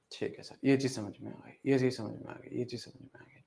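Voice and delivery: low, hushed student reply, fading to a whisper; imitated meek reply, trailing into near silence; mimicking students. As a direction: murmur it under the breath as a student. Voices students saying they understood.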